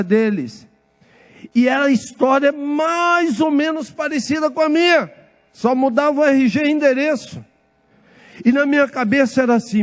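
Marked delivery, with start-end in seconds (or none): 1.5-7.4 preaching emphasis. Real, not acted. Preacher stresses his testimony.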